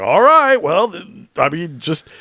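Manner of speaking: mock scientist. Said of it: "And he's like".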